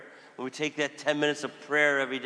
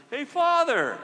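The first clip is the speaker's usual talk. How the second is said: high, loud greeting call; 13 semitones higher, 6 dB louder, slower than usual. Retold or imitated. Hospital visitor calling out to the priest, high and loud. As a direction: call out to the priest across the hall. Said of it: "someone says".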